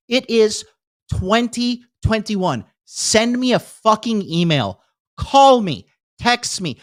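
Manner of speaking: high, indignant demand with each phrase punched; exasperated rant. Real, not acted. Ranting at the bank's failure.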